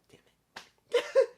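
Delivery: half-laughing self-annoyed yelp; playful burst of frustration; frustrated outburst. Real, not acted. Annoyed at getting name right late.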